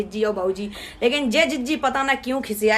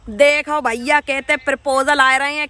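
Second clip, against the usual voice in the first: usual speaking voice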